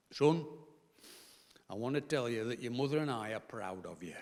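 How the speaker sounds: lightly voiced father, low and soft; a touch of a proud dad, quiet; his dad. Father telling his son he is proud, hushed lightly voiced. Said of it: "and I'm my dad".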